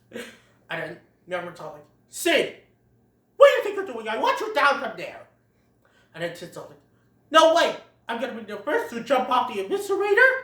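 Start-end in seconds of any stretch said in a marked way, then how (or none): 2.1-5.3 high, loud stern call; scolding. Retold or imitated. Manfred ordering Sid down, loud and high. 7.3-10.3 fast, high, loud bragging; boasting. Retold or imitated. Sid bragging to the herd, loud fast boast. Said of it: "in a boasting tone".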